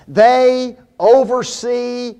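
"they" and "oversee" drawn out slowly; slow, weighty stress on "oversee"; deliberate emphasis. Real, not acted. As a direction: spell out "they oversee" word by word. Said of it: "and I emphasize that word".

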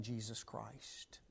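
slow soft whisper; whispered name. Acted. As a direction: breathe the name reverently. Hushed close of a thought.

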